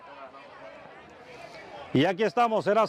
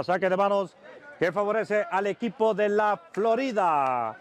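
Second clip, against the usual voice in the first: ordinary talk, nothing marked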